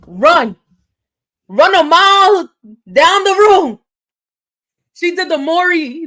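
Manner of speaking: high, loud excited rush; gushing over the dramatic moment. Real, not acted. Host recounting Danielle's sprint to viewers, excited.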